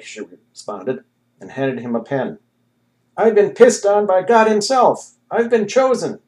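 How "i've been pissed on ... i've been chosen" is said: high, loud, fervent claim; ecstatic insistence. A narrator's reading. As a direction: proclaim it, carried away. Prophet insists to nurse.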